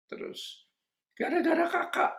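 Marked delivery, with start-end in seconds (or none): 1.1-2.1 high, tearful-style blame; mock weepy complaint, pitched far up; imitated crying. Girl returns crying to blame Kakak, imitated high whine. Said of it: "dan dia nangis".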